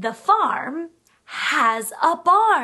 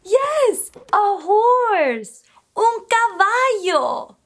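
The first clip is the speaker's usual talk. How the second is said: ordinary talk, nothing marked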